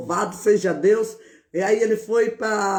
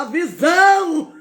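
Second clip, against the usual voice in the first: excited cry; 7 semitones higher, 5 dB louder than usual. Retold or imitated. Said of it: "eu falava".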